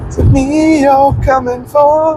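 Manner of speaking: slow, high, eerie drawn-out voice. Pretend. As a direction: sing it high and slow like a ghost. Playful creepy reply to whisper.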